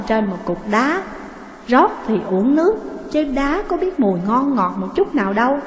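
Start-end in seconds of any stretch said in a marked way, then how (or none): none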